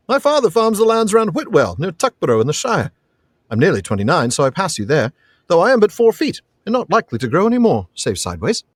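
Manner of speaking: lightly voiced hobbit, lilting upward; good-humored Pippin. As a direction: answer the boy with gentle humor. Reads Pippin's dialogue.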